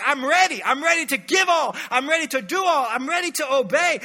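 loud, eager I'm ready, hammed up; mock eager pledge. Preacher mimics believers wanting a grand gesture, loud mock eagerness. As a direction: play the overeager volunteer.

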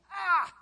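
high, loud eternal regret groan; anguished groan. Shown as a demonstration. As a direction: wail an "ah" of loss. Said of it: "stop saying".

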